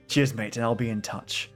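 usual speaking voice

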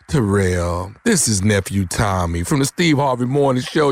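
low, drawn-out announcer reveal; prank reveal. Acted. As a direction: drop the voice low to unveil yourself. Prankster begins his big reveal.

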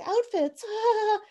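high, giggly airhead imitation; mock the flustered heroine; ditzy actress. Podcaster mocking the actress, high and giggly.

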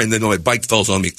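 normal talking voice

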